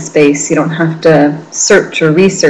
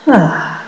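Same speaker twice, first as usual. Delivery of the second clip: sigh out; 4 semitones higher than usual. Shown as a demonstration. Teacher exhaling with the class, relaxed audible sigh. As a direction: let the held breath go. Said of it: "sigh it out".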